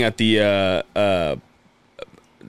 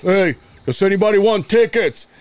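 loud, high mock boss; put-on boss impression, pitched up and loud; funny boss voice; 8 semitones higher, 4 dB louder than usual. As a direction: call out in a high, goofy persona voice. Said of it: "you made this f-, funny voice".

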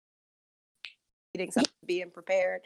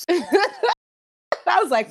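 laughing; 9 semitones higher, 6 dB louder, much slower than usual. Real, not acted. Laughing while starting a retold quote.

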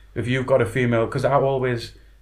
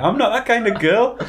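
mock prim, high-pitched; 9 semitones higher than usual. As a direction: squeak it out primly. Retold prim refusal.

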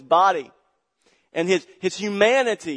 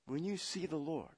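much softer repeat of when you see the Lord; sudden hush; 15 dB quieter than usual. Real, not acted. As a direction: drop to a near hush for weight. Repeated line, hushed take.

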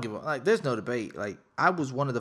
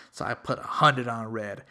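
usual speaking voice

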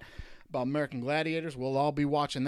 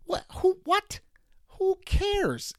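high mocking disbelief; incredulous outburst; much slower than usual. Real, not acted. Host scoffing at a pointless record, high and incredulous. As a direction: squeak out what and who like it's absurd.

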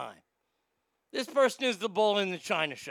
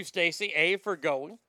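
usual speaking voice

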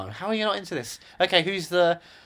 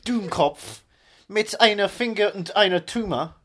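deliberate, halting fake-German recital; straight-faced Germanised English; mock German accent; 5 semitones higher, 4 dB louder, slower than usual. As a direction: recite pop lyrics as a cartoon German.

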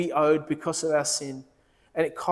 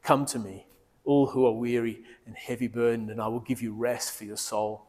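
soft, lower invitation to rest; tender voice of Jesus; 7 semitones lower, 5 dB quieter than usual. Acted. Preacher voicing Jesus inviting the weary, softly. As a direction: speak it gently as a loving invitation.

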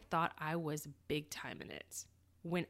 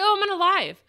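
high, loud, bubbly reply; excited reply; 10 semitones higher, 11 dB louder, faster than usual. Retold or imitated. Telling her husband she's on a live, thrilled and loud. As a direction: tell him brightly you're live on air.

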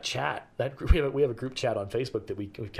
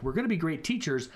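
usual speaking voice

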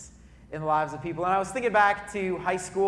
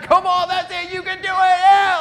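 much higher and louder, yelling encouragement; reenacted cheering shout; 12 semitones higher, 11 dB louder than usual. Pastor reenacting his team cheering from the stands, full shout. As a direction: shout it like a fan courtside. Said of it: "we shouted".

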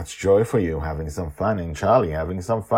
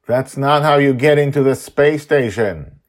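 louder, higher grumbling grandpa; grandpa objecting; 8 semitones higher, 9 dB louder than usual. Acted. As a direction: protest like an embarrassed grandpa. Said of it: "I say".

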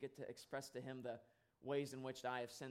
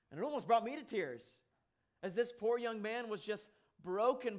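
higher and louder, pressing with emotion; moved preaching; 7 semitones higher, 4 dB louder than usual. Acted. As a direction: let the feeling rise as you preach it. Preaching at full force.

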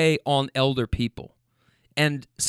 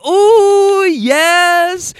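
very high, loud whooping imitation; hammed-up squeal of approval; mock cheering; 9 dB louder than usual. Riders erupting at his answer, loud mock cheer. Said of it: "start cheering and clapping".

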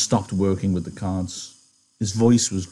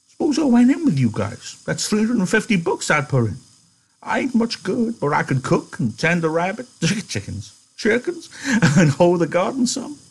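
put-on much higher, quavering old man; old Candy; 10 semitones higher than usual. Candy asking George about the farm, old and hopeful. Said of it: "he leaned forward eagerly".

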